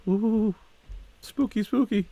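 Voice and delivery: high-pitched mock-ghostly "ooh"; mock spooky. Viewer making fun of bad Photoshop scare, mock spooky. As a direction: tease it with a high, fake-scared coo.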